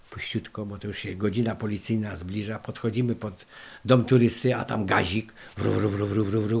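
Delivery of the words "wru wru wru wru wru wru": rumbling vroom of an idling jeep; engine noise imitation. Storyteller imitating the police jeep idling outside, engine rumble. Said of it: "chodzi gazik".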